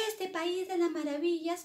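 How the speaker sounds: put-on high-pitched storybook girl complaining; fed-up Alicia. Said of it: "se cansó y dijo".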